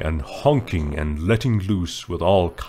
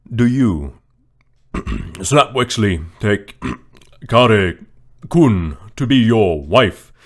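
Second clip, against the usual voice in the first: slower, louder, clearing the throat mid-line; throat-clearing officiant; 3 semitones higher, 6 dB louder than usual. Acted. Poe restarting the vows after the fart, throat-clearing and deliberate. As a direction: push through the vows, pausing to clear the throat. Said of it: "clears throat".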